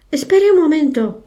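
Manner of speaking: called out high and loud; urgent high call across the water; shout. A narrator's reading. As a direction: shout after the boat to make it wait. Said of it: "gritó Val entonces".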